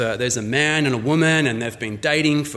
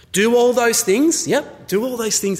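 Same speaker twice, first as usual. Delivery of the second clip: higher, louder list-closing insistence; breezy demand; 7 semitones higher, 4 dB louder than usual. Acted. Woman setting conditions for marriage, breezily demanding. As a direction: lay down terms in a raised voice. Fiancée dictating terms for marriage.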